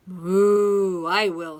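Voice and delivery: very loud moo, then acceptance; mooing cow. A narrator's reading. Cow accepting the hen's bread, mooing loudly. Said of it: "said the cow".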